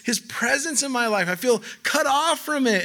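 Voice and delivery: high, anguished plea from a despairing psalmist; lifted, pained voice of a man who feels cut off from God; distressed psalmist. Acted. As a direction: voice him crying out, close to breaking. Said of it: "He's like".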